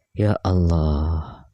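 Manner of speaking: low, long-drawn sigh of dismay. Real, not acted. YouTuber reacting to talk of war, dismayed. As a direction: draw it out low and very slowly, weary of it.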